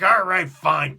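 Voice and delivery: loud mock-exasperated giving in; exasperated concession. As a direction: burst out as if grudgingly caving. Hero gives in, exasperated, retold.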